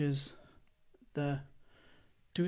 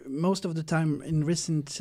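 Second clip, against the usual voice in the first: normal talking voice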